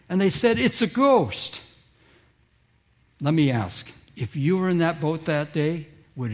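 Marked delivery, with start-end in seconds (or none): 0.6-1.6 higher, slow mock fearful cry; a hammed-up terrified gasp of words. Disciples in the boat seeing a figure on the water, mock terrified and higher. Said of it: "they cried out in fear".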